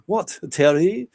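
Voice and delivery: put-on slow, high French Canadian accent; curious French Canadian accent, drawn out. Rookie answering Terry in a slow French Canadian accent. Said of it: "in his thick French Canadian accent".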